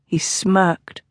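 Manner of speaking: ordinary delivery, nothing marked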